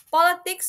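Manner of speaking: "Politics" high and loud on POL; stress on first syllable. Shown as a demonstration. Teacher introduces the word, "Politics" stressed on POL. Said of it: "it has an emphasis".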